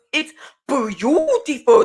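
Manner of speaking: drawn-out, swooping grandma voice; grandma's drawn-out talk. Retold or imitated. Grandma admiring the baby's crocheted blanket, mimicked drawl. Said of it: "That's how she talked".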